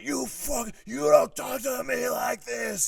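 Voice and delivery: high, slow, strained shout; hammed-up screaming rage, drawn out; retold raging boss. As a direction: imitate a boss losing his temper completely. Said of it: "very serious anger management issues".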